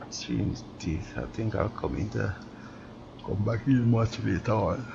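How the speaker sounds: talking through a yawn; words stretched by a yawn. Real, not acted. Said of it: "Sorry about the yawn".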